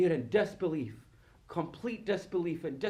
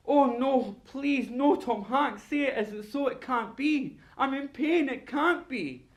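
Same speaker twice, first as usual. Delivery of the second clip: high, loud mock-tragic pleading; over-the-top tearjerker despair, pitched high; hammed-up movie anguish; 8 semitones higher, 4 dB louder, slower than usual. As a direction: wail in melodramatic disbelief. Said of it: "all the movies where you have to go".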